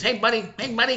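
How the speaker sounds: high-pitched pestering buddy voice; Boston coworker impression. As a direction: imitate the guy in your face. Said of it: "That's how he talks".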